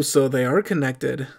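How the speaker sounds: ordinary talk, nothing marked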